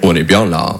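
very low, contented mock-French remark; imitated French contentment. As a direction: drop it low, like a satisfied local. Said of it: "vous le dites tout le temps".

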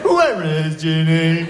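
drawn-out mock lesson question in French-accented English; slow classroom English. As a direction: stretch each word like a language drill. Parodies school English lesson question.

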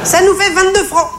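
voix de caissière très aiguë; vendeuse qui glapit. Acted. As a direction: annoncer le prix en glapissant. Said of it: "glapit-elle". (caissière à la voix criarde et suraiguë)

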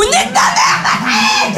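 shrieked very high, laughing; screamed insult. Acted. Comedian mocking a relative with a shrieked insult.

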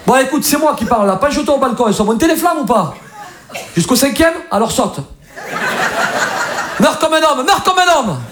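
high-pitched, exasperated Marseille fireman; mock Marseille accent. Marseille fireman answers a caller trapped upstairs, mock Marseille accent. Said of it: "C'est ça, l'accent".

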